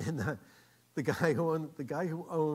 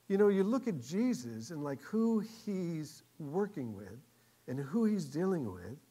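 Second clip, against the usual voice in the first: usual speaking voice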